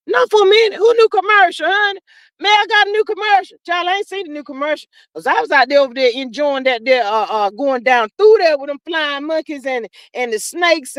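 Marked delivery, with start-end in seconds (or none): 0.1-4.9 surprised protest. Real, not acted. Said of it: "I got scared for a minute".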